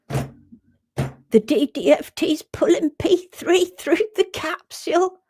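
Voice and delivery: slow, high-pitched mocking quote; mocking imitation. Mimicking those still blaming pulling through the capsule, mocking. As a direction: parrot it in a silly high voice. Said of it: "they're still, like, going".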